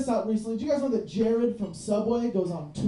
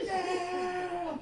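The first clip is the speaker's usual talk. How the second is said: excited catch; 8 semitones higher, 6 dB quieter than usual. Retold or imitated. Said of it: "you're like".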